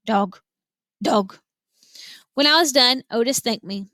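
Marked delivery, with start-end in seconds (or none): 0.1-1.4 quiet croak. A narrator's reading. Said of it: "croaking real quiet to herself".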